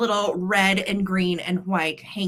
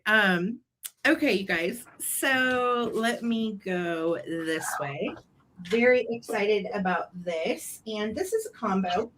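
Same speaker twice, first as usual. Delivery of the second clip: normal talking voice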